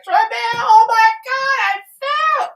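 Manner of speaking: high, sing-song wail for help, hamming it up; hammed-up singsong cry of I fell; overly dramatic wail. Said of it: "I was being very extrally dramatic".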